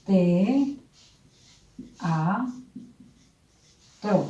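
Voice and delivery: "Te, a, tro" spoken softly with long pauses between syllables; separated syllables. Shown as a demonstration. Teacher separating the word for students, long pauses. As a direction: let each syllable of "Te, a, tro" stand alone. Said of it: "Separémosla".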